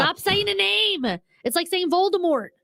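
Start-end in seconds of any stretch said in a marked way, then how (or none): 0.0-1.2 high, slow, mock-pained plea; mock outrage. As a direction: beg them to stop, hammed up. Mock outrage over Jane Fonda.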